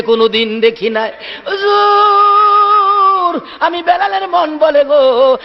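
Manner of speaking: melodic wail with long-held calls; acted tearful plea. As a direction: lament like a grieving companion, stretching the cries. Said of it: "চোখের পানি ছেড়ে ছেড়ে বলতেছেন". (কান্নার সুরে টেনে টেনে আকুতি)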